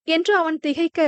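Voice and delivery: ordinary delivery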